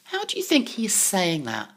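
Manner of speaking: ordinary delivery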